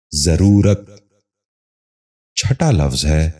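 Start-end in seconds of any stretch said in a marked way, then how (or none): 0.1-0.9 ضرورت starting with "za", "za-roo-rat"; correct pronunciation. Shown as a demonstration. Teacher giving viewers the model, correct pronunciation. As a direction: say ضرورت as zaroorat. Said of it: "ذہن نشین کر لیجئے".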